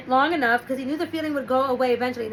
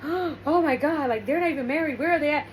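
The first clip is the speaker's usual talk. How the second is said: mock scandalised; 2 semitones higher than usual. Townsfolk whisper about the runaway daughter, mock shock. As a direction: play a shocked, gossiping neighbour lightly. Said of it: "everybody's in shock and horror".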